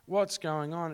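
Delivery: high, pleading cry to God, mildly tearful; retold tearful plea. Man retelling his prayer at the conference, pleading and mildly tearful. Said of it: "like, I was crying".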